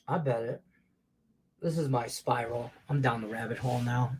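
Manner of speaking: usual speaking voice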